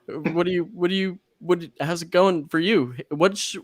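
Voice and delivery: high, flustered fan babble; starstruck fan. Retold or imitated. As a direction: stammer it out, too eager and high. Flustered fan talking to Hayden.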